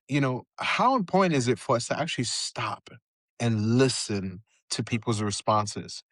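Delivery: ordinary talk, nothing marked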